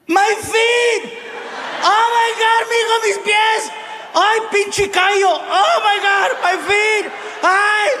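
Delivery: very high-pitched melodramatic Mexican mother; hammed-up high cries of agony; mom wailing in pain. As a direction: wail like a telenovela mom with aching feet. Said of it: "My mom felt no pain".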